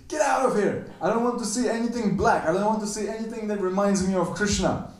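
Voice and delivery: high, loud furious cry; Radha's jealous, shrieking anger; angry outburst. Retold or imitated. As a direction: shout her away in a jealous rage. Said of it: "even though she's very angry".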